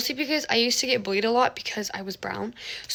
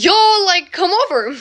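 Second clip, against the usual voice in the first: loud, high imitated call; 6 semitones higher, 8 dB louder than usual. Boy calls to kids tables away, calling out. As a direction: call across the lunchroom.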